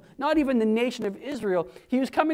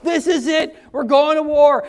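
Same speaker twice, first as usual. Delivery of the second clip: a mock eager battle cry, getting louder; excited follower; 4 semitones higher, 6 dB louder than usual. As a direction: pump it up like a soldier itching to fight. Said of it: "His number one follower thought".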